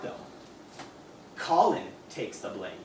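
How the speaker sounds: normal talking voice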